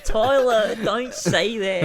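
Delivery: high, slow laughing plea. Real, not acted. To Tyler, laughing while begging him to stop. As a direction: protest through giggles.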